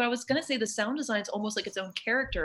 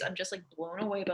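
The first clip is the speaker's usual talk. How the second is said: normal talking voice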